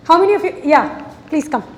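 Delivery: ordinary talk, nothing marked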